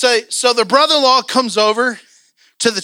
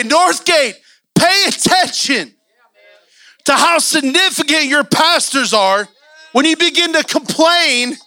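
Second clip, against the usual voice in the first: preaching at full force; 5 semitones higher than usual. Acted. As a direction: preach it hard to Northgate.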